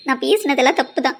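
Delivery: ordinary talk, nothing marked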